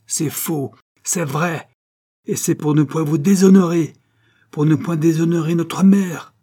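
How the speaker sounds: high, increasingly loud outburst; impassioned justification. A narrator's reading. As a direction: build from protest to loud confession. Duke hotly confesses, justifying himself. (justification véhémente)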